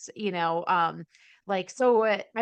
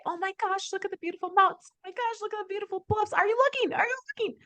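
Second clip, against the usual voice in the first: much higher, fast, breathless sightseeing gush; gushing excited mom; 12 semitones higher, faster than usual. Retold or imitated. Mom on road trip gushing about mountains to family, high and fast. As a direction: squeal at the scenery to pester the kids. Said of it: "do what I did ... and say".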